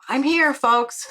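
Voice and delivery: acted-out insistent call to the room; putting his foot down. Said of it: "he put his foot down".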